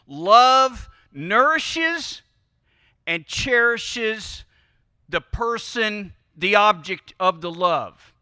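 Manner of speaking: very slow, each word weighed; slow, weighty preacher's stress; slow emphasis. Real, not acted. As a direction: draw out "nourishes" and "cherishes" deliberately.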